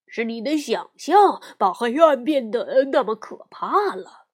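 lightly voiced patient bear dad; papa bear voice. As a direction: explain like a kind father. Papa explains gently.

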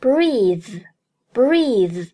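"breathe" with long ee and buzzing th; "breathe" modelled as the verb form; verb pronunciation. Shown as a demonstration. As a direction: stretch the vowel in "breathe" and voice the ending. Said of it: "动词的呼吸应该读作".

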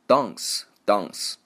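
slow British "dance" with a long "ah"; British accent. Shown as a demonstration. Teacher shows how the British read it, British accent. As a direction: say "dance" slowly as British "dahnce". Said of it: "英国人会读".

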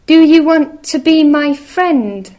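high, loud eager little mouse. Acted. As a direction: call out brightly like a tiny mouse. Mouse asks each animal.

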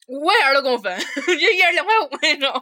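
loud, high, laughing through it. Real, not acted. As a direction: laugh at the stinginess. Laughing at splitting bill. (边笑边说)

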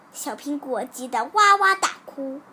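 ordinary delivery, nothing marked

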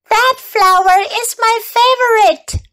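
high, squeaky little bee; buzzing insect voice. Acted. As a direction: play a tiny buzzing bee. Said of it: "buzzed the bee".

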